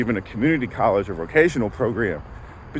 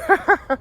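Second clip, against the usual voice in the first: breathless laugh. Real, not acted. Breathless laugh mid-walk.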